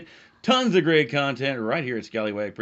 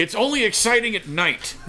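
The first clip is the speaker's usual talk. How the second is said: higher, laughing through the joke; 10 semitones higher than usual. Real, not acted. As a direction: crack up while saying it.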